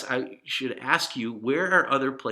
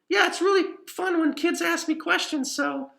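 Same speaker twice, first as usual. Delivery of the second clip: higher, cheery cartoon cat voice; Pippin the cat puppet; 8 dB louder than usual. Puppet cat answering the author for the kids, higher and cheery. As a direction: chirp it up high like a friendly puppet cat. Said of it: "right, Pip?".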